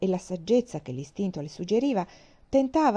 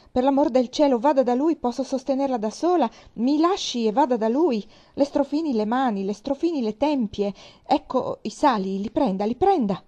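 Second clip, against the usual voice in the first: loud, higher-pitched frantic orders; urgent shouting; 5 semitones higher, 6 dB louder than usual. A narrator's reading. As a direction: fire off pleading commands at raised volume. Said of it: "gridò Anne".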